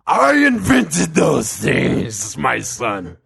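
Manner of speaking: slow, rough growl; scary demonic God. Acted. God corrects his son, slow scary growl. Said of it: "God's like".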